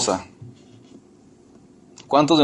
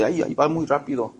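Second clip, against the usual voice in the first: mock wife reply; 6 dB quieter than usual. Wife mocked answering.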